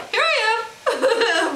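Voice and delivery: high, laughing cat voice; playful pet voice through laughter; voicing the cat. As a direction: voice the cat popping up. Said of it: "He lifted up his head like".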